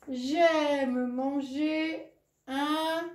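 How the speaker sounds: slow reading aloud. Shown as a demonstration. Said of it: "le point à la fin".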